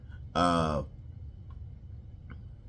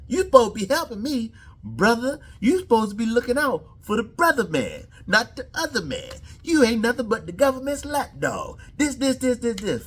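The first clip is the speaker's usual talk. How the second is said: much higher, louder, outraged imitation; indignant Fury; 13 semitones higher, 4 dB louder than usual. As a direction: play Fury wounded and betrayed. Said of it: "the look on Fury's face".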